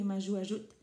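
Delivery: usual speaking voice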